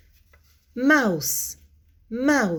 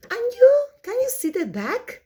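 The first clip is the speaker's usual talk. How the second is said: excited high prompt; 13 semitones higher, 6 dB louder, much faster than usual. Acted. Prompting kids eagerly.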